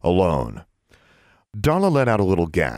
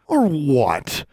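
high, mocking challenge; girl sneering at an older boy; 9 semitones higher than usual. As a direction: sneer the question back at him. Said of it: "Alexandra sneered".

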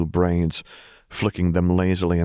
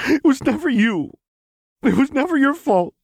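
high, loud tearful wail; sobbing; 5 dB louder than usual. A narrator's reading. Lux telling Jinx she is blameless, sobbing. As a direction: sob out a desperate reassurance. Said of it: "Lux sobbed".

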